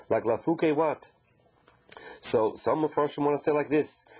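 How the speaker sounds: ordinary delivery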